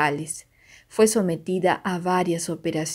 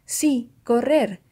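encouraging; 5 semitones higher, slower than usual. A narrator's reading. Narrator encouraging the girl.

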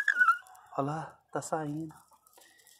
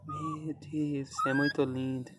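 ordinary talk, nothing marked

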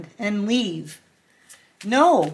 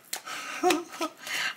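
breathy laugh; 8 semitones higher, 10 dB quieter than usual. Real, not acted. Laughing at Libra's bluntness.

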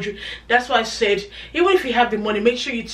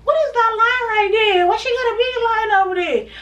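high, laughing mock question from viewers; mock curious viewer; 12 semitones higher, 4 dB louder than usual. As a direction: squeak it like a nosy viewer pointing. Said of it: "so you guys be like".

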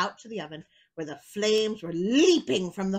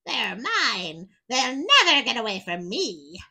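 louder gloating witch voice; scoffing witch; 7 dB louder than usual. Acted. Witch gloating over her captives, louder scoff. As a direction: crow it out like a greedy witch. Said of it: "and scoffed".

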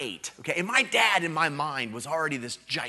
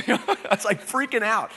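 laughing; 9 semitones higher, 7 dB louder than usual. Real, not acted. Laughing while telling.

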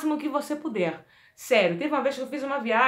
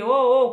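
voiced demo; 9 dB louder than usual. Shown as a demonstration. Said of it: "sonorizando aqui".

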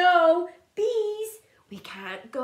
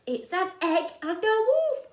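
ordinary delivery, nothing marked

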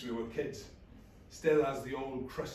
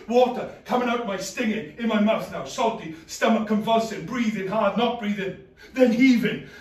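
panicked gasping; 9 semitones higher, 14 dB louder than usual. Acted. Drowning, gasping panic monologue.

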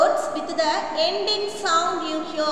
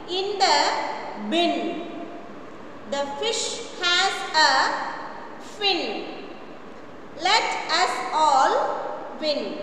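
slow model reading; slower than usual. Shown as a demonstration.

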